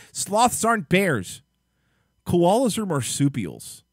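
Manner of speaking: slow, loud, high and exasperated; mock exasperated rant. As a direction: spell it out in disbelief.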